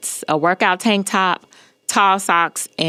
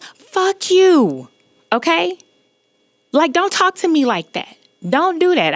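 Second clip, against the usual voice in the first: pitched-up angry outburst, retold; retold cursing out; 11 semitones higher than usual. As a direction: re-enact telling him off in a much higher pitch. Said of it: "cursing this man".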